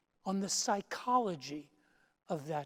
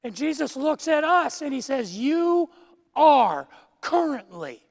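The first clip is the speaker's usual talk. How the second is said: full-force preaching; 4 semitones higher, 6 dB louder than usual. Acted. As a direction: proclaim it to the congregation. Preaching builds to climax.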